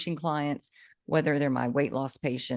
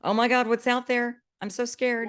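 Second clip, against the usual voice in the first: high-pitched, put-on scared voice; mock fearful; 8 semitones higher than usual. Friend imitating someone fearful of Monday, mock scared. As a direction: act out dread of the unknown, pitched up. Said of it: "Instead of being fearful of it".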